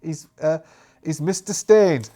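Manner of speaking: ordinary talk, nothing marked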